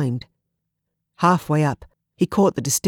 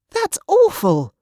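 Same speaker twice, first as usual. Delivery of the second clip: high, slow, loud outcry of disgust; 5 dB louder, slower than usual. Acted. Olivia hearing about the slave children, disgusted high outcry. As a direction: recoil at the cruelty. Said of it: "Disgust flared on her face".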